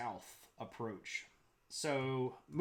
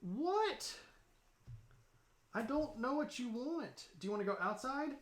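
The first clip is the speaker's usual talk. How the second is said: high sing-song pet voice; talking to the dog; 10 semitones higher than usual. Real, not acted. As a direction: coo at the puppy, much higher.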